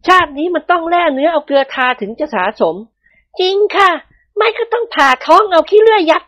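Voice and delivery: high, firm woman's voice; a wife set on catching her husband; high-voiced wife, resolute and grim; determined young wife. A narrator's reading. Wives plot revenge.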